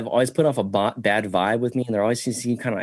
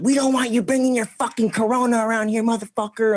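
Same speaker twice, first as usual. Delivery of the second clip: high shrill-free mock yell of the daughter, much higher; mock angry daughter; 12 semitones higher than usual. Daughter cussing him out over the ice machine, mocked high.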